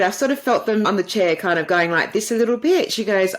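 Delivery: ordinary talk, nothing marked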